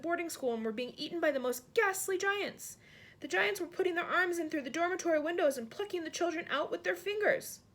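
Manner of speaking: high, tense telling of a nightmare; the queen tells her maid about the nightmare, high and shaken; distressed queen. Acted. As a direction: relive the bad dream aloud.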